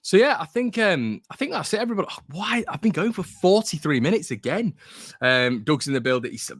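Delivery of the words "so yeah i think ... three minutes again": high, surprised wrap-up that breaks into a startled "why?". Real, not acted. Host realises to his viewers the stream ran long, pitched high in surprise. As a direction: suddenly realise how long you've been going.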